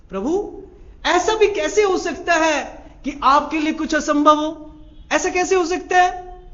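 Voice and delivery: high, loud, urgent pleading; a devotee's high, loud plea to the Lord. Acted. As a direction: beg the Lord with rising urgency.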